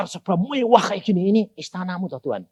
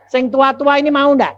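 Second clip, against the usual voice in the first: high, loud teasing question; teasing call; 9 semitones higher, 6 dB louder than usual. Real, not acted. Preacher teasing the elderly in the pews, loud and high.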